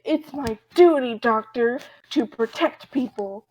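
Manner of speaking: loud, dutiful proclamation; emphatic declaration. Retold or imitated. Thompson declares his duty to the doctor, emphatic declaration. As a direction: declare it forcefully, drawing out the end.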